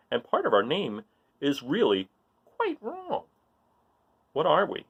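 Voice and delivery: lively read-aloud voice for a picture book; reading a riddle book aloud. A narrator's reading. Reading a riddle page to children, playful read-aloud.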